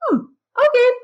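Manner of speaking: high imitated child reaction; Avery. Daughter hearing mom explain her anger, high-pitched child reaction. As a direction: mimic a kid taking it in, starting very high. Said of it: "then she was like".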